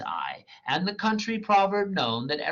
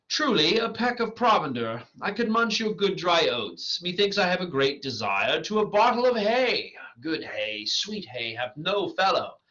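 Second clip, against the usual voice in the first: Bottom; 6 semitones higher than usual. Acted. Donkey-headed Bottom asks for hay.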